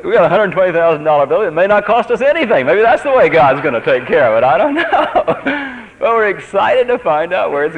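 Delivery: real excitement bubbling into laughter; excited. Real, not acted. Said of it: "And I'm excited about it".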